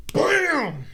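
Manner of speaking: high, punchy "bam"; exclaimed impact noise; mimicked punch sound. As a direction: burst it out as an imitated hit. Acting out [name]'s punch.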